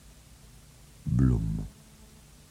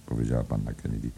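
ordinary delivery

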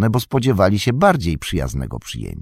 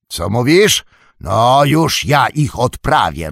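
a louder, higher innkeeper voice booming from inside; Butterbur's gruff call; 6 semitones higher, 7 dB louder, slower than usual. Acted. Butterbur calling from inside, ready to chase off guests. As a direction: threaten to send them packing. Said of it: "rozległ się głos Butterbura".

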